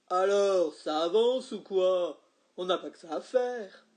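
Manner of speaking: loud, impatient complaint; protesting customer. A narrator's reading. Man in the queue complaining, loud. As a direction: grumble at the hold-up. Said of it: "Un monsieur a protesté".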